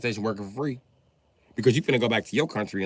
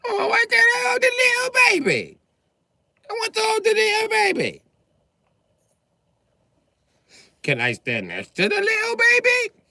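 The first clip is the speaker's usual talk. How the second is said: put-on very high, loud whining child; whining little girl; 6 dB louder than usual. Elvira whining to her parent, very high pitched. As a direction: whine it like a begging child. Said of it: "voice is so high-pitched".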